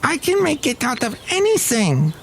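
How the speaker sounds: high, affected grand-lady voice; Madame Rutabaga. Pretend. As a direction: gush like a grand society madam. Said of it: "Madame Rutabaga".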